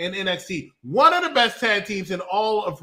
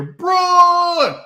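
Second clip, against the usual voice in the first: high, long shouted name; mock roar; 14 semitones higher, 8 dB louder than usual. The roar nobody expects to hear, mock bellow. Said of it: "to hear".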